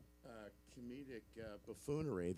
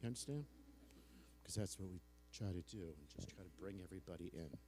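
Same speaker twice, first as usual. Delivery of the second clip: intimate hush; 2 semitones lower, 11 dB quieter, faster than usual. Real, not acted. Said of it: "See how I dropped it down?".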